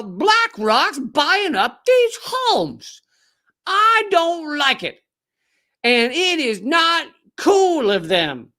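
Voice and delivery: slow, high mock Southern accent. Comedian imitating a TV host in a slow, high Southern accent. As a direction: drawl it out in a put-on Southern accent. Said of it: "Tough to do that Southern accent".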